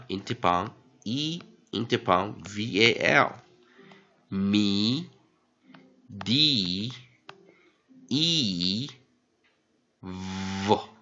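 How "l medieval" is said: medieval said extremely slowly; stretched-out model word. Shown as a demonstration. Teacher finishing a spelling drill with a very slow medieval. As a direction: stretch medieval out to its full length. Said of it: "再来一遍".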